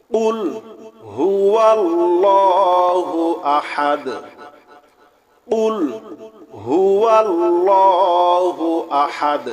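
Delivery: high, drawn-out chanted verse; slow Quran recitation. Shown as a demonstration. Teacher recites the verse slowly for students, chanted. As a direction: stretch the verse slowly like tilawat. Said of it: "কফ লাম পেশ কুল".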